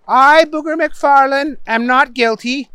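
high-pitched model sentence; upset judge prompting. A narrator's reading. Judge giving Booger words to repeat, high but restrained. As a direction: prompt him with strained patience. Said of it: "The judge was getting upset".

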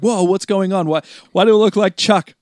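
high, loud panicked bewilderment; Billy as Shazam panicking at his new body, high and loud; freaking out. Retold or imitated. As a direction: yelp it like a kid suddenly in a grown man's body. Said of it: "like, freaking out".

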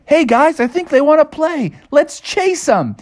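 high, eager cartoon bear cub; rally the pals, stretching the last words; very high, mischievous voice; playful Bubby. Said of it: "Bubby was like".

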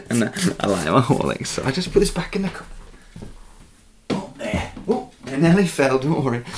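usual speaking voice

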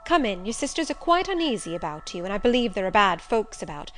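loud, animated, pitch leaping up; excitable servant, flustered then scolding. Acted. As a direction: fuss over the master, then order the beggar off. Servant greets master, scolds beggar woman.

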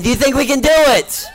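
pushing the crowd to shout back. Shown as a demonstration. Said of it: "two people think we can".

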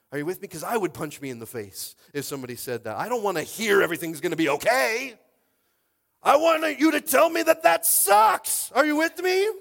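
very high, very loud, slowing pulpit cry; full-force preaching. Acted. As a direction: build to a roaring climax.

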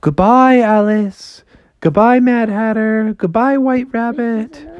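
high, lilting goodbyes; Froggy's farewell. Acted. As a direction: call farewells while floating away. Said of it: "And he's like".

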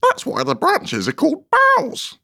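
very high, squeaky flower shout; tiny excited squeaker; little shrill daisy. A narrator's reading. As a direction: pipe up shrilly and loudly. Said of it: "cried another daisy".

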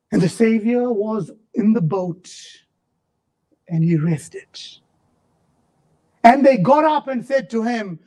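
ordinary talk, nothing marked

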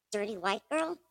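normal talking voice

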